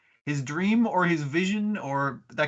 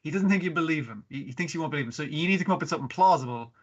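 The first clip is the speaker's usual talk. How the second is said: normal talking voice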